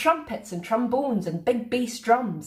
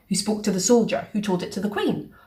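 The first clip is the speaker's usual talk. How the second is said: plain reading voice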